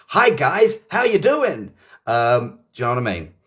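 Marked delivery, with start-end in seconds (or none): 0.1-1.7 jarring, high-pitched American greeting; American accent. Acted. British teacher mocks jarring American accents, high-pitched American accent. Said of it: "Tired of the American accent".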